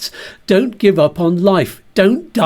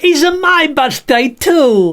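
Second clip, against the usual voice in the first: higher, slower puppet voice; Bert voice; 8 semitones higher, slower than usual. Acted. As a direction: cut in as the boastful bag puppet. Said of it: "This is Bert the Bag".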